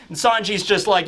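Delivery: normal talking voice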